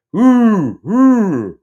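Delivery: high, loud owl hoot. Retold or imitated. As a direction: hoot loudly like an owl coming closer. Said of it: "and it's louder".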